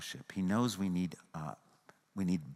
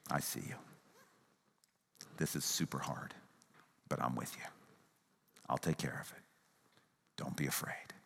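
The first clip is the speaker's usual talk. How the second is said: much softer, tender, ending in a whisper; kind, loving Jesus; 8 semitones lower, 10 dB quieter than usual. Pretend. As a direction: comfort someone gently and quietly. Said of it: "Hear an empathetic, kind, loving Jesus".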